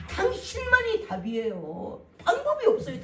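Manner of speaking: high, loud pleading, slow and breaking into laughter; hammed-up desperate begging; desperate plea. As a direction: beg him, you are the only answer. Woman pleads with Jesus.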